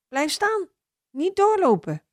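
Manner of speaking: put-on high, anxious mother calling her child; a mother calling her toddler back, worried. A narrator's reading. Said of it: "Een moeder in het park maakt zich druk". (bezorgde moeder die haar kind terugroept)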